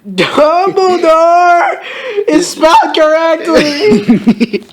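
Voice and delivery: very high, slow, stretched shout; amazed shriek. Real, not acted. Reader amazed at the story's one correct spelling, shrieking. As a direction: shriek each word out, breaking into laughter.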